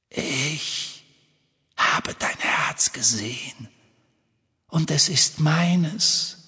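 a slow, whispered hiss rising at the end; hissing Horcrux voice. A narrator's reading. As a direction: let the evil locket hiss its claim. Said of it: "zischte eine Stimme aus dem Horcrux". (zischende Stimme aus dem Horkrux)

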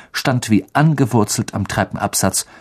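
usual speaking voice

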